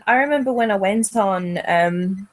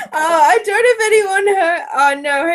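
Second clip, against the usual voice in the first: embarrassed laughing; 8 semitones higher, 3 dB louder than usual. Real, not acted. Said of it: "I didn't hear it".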